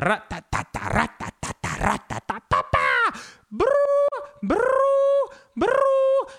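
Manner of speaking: rising, high-pitched rattling noise that ends in loud buzzing; brain noise. Pretend. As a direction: imitate the racket in your head, climbing high and loud. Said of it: "It's just got a really bad voice".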